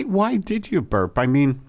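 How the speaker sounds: usual speaking voice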